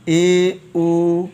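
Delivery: ए, ओ said out slowly and loud, each held; sounding out vowels. Shown as a demonstration. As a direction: sound out ए and ओ clearly for the class. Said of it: "teacher sounding out vowels".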